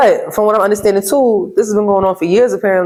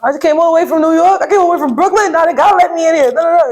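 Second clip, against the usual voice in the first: upset protest; 11 semitones higher than usual. Retold or imitated. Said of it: "And I'm like".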